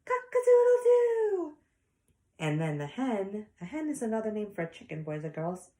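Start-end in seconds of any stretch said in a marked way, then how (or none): 0.0-1.6 very high, loud rooster crow. Acted. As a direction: crow like a rooster waking the farm. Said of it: "by saying".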